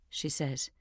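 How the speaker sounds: ordinary delivery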